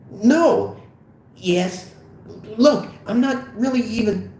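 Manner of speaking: high, halting panic; anxious high-pitched protest; flustered denial. Acted. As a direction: backtrack nervously in a high voice. Fogel flustered under questioning.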